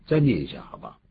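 usual speaking voice

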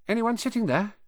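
put-on high, hesitant young boy; shy boy voice. A narrator's reading. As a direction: ask timidly like a shy schoolboy. Ron asks about the seat.

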